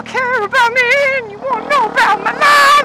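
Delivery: high, loud mock self-importance; sarcastic high voice. Telling viewers they care about his life, mock vain and high. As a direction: tease the viewers like a vain star.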